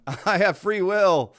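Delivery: high, loud, laughing through a defiant declaration; mock heroic yell, breaking into laughter; mock defiant shout. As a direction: ham up a brave abductee yelling at aliens. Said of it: "Some guy's going".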